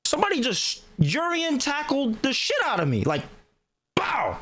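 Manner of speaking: normal talking voice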